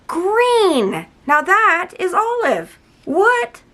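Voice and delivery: high, drawn-out astonishment; wowed and disbelieving; amazed reveal. Real, not acted. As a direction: announce it like a stunning reveal, ending in a high squeal. Reveals the surprise color change.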